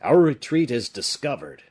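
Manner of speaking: loud, urgent warning; alarmed cry. A narrator's reading. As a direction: raise the alarm. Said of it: "cried the bishop".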